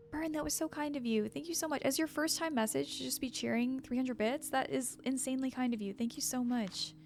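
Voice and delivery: usual speaking voice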